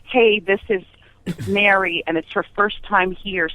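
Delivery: ordinary delivery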